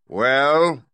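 loud, high, commanding voice of the giant head; the great head pronounces from the throne; booming great head. Acted. Great head booming at Dorothy, loud and commanding.

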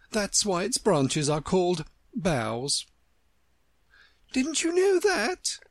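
very high, slow, smug voice; cheeky daisy. Acted. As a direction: tease like a know-it-all.

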